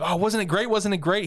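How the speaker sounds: high, eager retold gushing; gushing excitement. Angel to him after the flight, gushing.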